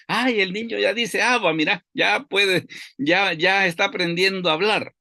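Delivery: high, thrilled parent's cry; delighted parent. Retold or imitated. Parent announcing the baby's first word, high and thrilled. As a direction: burst out proud that the baby talks.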